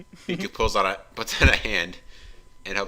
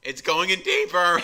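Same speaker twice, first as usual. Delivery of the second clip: exclaiming; 8 semitones higher, 7 dB louder than usual. Acted. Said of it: "Miku exclaimed".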